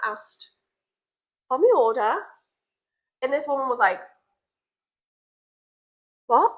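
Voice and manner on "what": usual speaking voice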